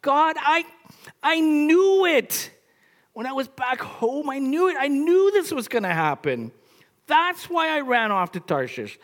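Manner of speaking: angry yelling at God. Acted. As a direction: rant at God, fed up and indignant. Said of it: "He yelled at God".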